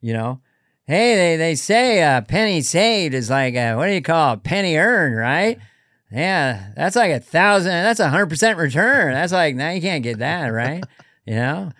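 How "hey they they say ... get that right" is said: folksy, meandering comic with lilting emphasis; rambling deadpan, swooping on key words; Norm. Acted. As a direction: puzzle aloud, searching for the phrase. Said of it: "David and I do Norm".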